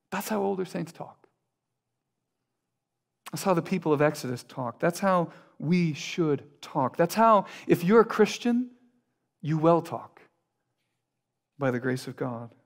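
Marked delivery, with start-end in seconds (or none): none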